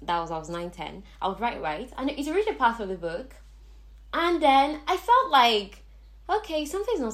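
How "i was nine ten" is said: ordinary talk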